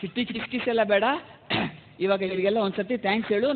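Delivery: normal talking voice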